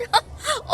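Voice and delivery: high scornful cackle; mocking laugh. Mother laughs scornfully at her son's girlfriend, a high mocking cackle.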